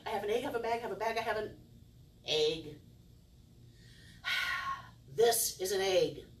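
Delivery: normal talking voice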